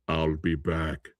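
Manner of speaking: a hint of Arnold's robot; lightly voiced Terminator; mock Terminator. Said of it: "No, let's change that".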